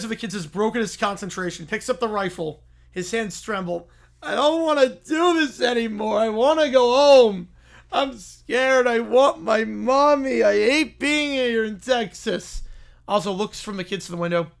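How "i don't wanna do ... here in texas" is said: put-on high, slow, childish whimper from a scared man; whining grown man. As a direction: whine like a kid who wants his mommy. Oswald whines like the kids.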